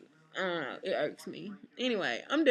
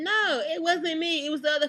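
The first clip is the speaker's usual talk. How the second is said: indignant; 10 semitones higher, 6 dB louder than usual. Real, not acted. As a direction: insist hard it wasn't you. Heated denial.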